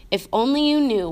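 usual speaking voice

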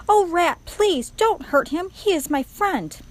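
high, loud, drawn-out pleading; crying out in plea. A narrator's reading. Princess begging the rat for her friend, crying out. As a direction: beg for a friend's life. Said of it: "The princess cried".

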